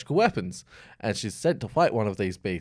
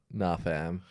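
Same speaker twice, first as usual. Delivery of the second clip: flat refusal; 6 semitones lower, 9 dB quieter than usual. Retold or imitated. Beast refuses the mother.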